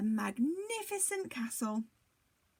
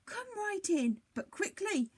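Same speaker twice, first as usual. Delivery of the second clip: hurried giantess; 9 semitones higher than usual. A narrator's reading. Giantess hurries Jack inside.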